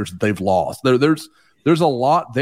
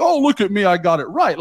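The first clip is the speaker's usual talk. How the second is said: high-pitched mock boast; mock gloating; 7 semitones higher than usual. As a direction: crow it like a parody know-it-all. Mock boast he disclaims making.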